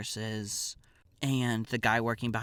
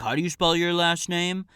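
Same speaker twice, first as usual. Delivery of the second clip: loud, raised-pitch mocking imitation; microaggressing; 7 semitones higher, 7 dB louder than usual. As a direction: imitate a guard asking with pointed disdain. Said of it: "obviously microaggressing at us".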